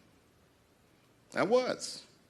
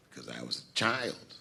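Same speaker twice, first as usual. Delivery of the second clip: usual speaking voice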